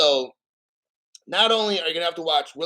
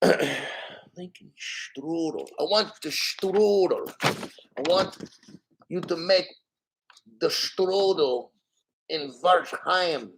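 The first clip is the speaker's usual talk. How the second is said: slow, halting fake German accent; mock German-Norwegian accent; much slower than usual. Shown as a demonstration. Streamer put on the spot for best German accent, halting mock German. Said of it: "mixture of some Norwegian and German".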